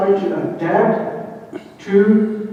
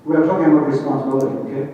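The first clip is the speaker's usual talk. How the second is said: ordinary talk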